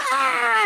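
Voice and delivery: high mock scream; imitated daredevil scream. Imitating jumpers off the rope swing, high mock scream. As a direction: let out a long, high mock yell.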